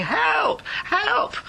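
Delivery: put-on trapped boy calling up for rescue; shouting for help. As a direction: scream for help from the flooding shaft. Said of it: "but he was shouting".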